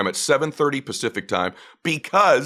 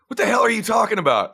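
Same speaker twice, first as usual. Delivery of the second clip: mock-baffled outburst, pitched up; incredulous; 8 semitones higher than usual. Son hears Dad botch the app name, incredulous. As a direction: imitate a friend's bewildered comeback.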